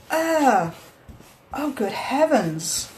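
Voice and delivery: slow high amazed groan; drawn-out shocked wonder; stunned. Real, not acted. More dirt out.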